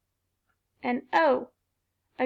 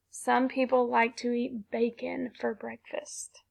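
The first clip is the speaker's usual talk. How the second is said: whisper; 5 dB quieter than usual. Shown as a demonstration. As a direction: whisper it slowly. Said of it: "sentence whispered".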